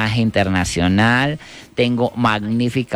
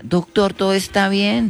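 higher-pitched, lightly acted nurse; nurse calling out; 6 semitones higher than usual. Nurse checks on the surgeon in the operating room, lightly acted calling. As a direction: call to the surgeon as a worried nurse. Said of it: "a gritar".

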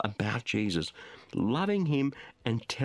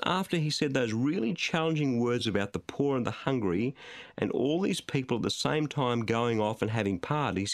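ordinary talk

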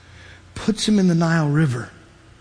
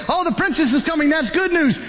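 higher, brighter, pleased exclamation; hopeful relief; 7 semitones higher than usual. Acted. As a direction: cheer as if rescue has come. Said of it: "Some of you say".

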